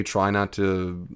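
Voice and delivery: normal talking voice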